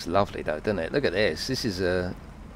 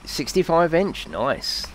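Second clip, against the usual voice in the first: usual speaking voice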